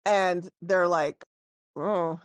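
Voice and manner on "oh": low-key, dropped little oh; shrugging oh. Acted. Orcs see the dwarf fall flat, shrugging. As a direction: toss it off with a shrug. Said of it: "and they kinda shrug".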